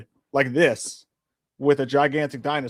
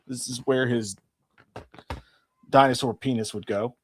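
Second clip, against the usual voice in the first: usual speaking voice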